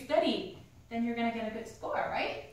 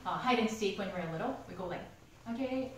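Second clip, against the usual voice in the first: normal talking voice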